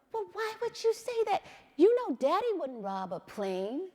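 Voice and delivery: half-mock startled parent; alarmed mother. Mother retelling how she questioned her son, alarmed.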